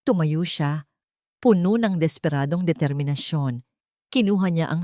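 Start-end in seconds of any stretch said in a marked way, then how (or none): none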